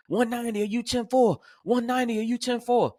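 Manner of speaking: high, urgent radio call; screaming partner. Retold or imitated.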